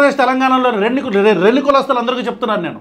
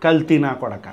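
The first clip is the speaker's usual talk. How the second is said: self-mocking jab; 8 semitones lower, 5 dB quieter than usual. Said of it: "నేను కూడా కల్తీ అయిపోతున్నా".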